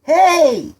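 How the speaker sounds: high, loud, drawn-out holler; yell. A narrator's reading. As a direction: yell to get their attention. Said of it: "he yelled".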